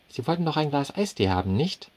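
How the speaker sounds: high, bright-voiced waitress asking. A narrator's reading. Waitress questions the foreign customer, high voice. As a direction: pitch it up as the waitress.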